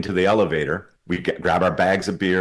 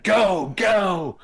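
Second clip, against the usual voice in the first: urgent go; 13 semitones higher than usual. Retold or imitated. Urging the cab driver.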